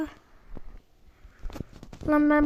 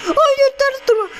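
higher pleading cry at the car; 9 semitones higher, 4 dB louder than usual. Real, not acted. Player pleading with flipping car, high alarmed cry. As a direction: beg the car not to land upside down.

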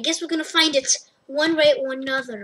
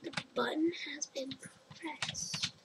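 soft breathy whisper; 15 dB quieter than usual. Real, not acted. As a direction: whisper it under your breath. Quietly exploring the maze.

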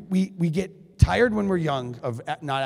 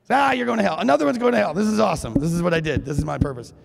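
gleeful mock God; 5 semitones higher, 4 dB louder, faster than usual. God voiced as gleeful punisher.

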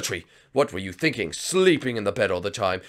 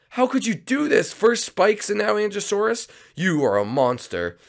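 much higher outraged sidekick voice; horrified sidekick; 11 semitones higher than usual. Acted. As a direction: wail at the villain in disbelief.

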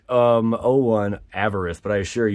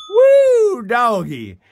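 very high loud whoop; woo cheer; 13 semitones higher, 7 dB louder, much slower than usual. Real, not acted. Celebrating the pack, a high whooping cheer. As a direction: whoop like at a game.

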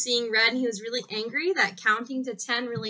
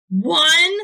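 loud count; 5 semitones higher, 9 dB louder than usual. Acted. Child counting to calm down.

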